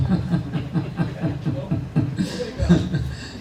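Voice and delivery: soft, breathy chuckling; laugh. Real, not acted. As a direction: let a quiet laugh run on. Laughing after own joke.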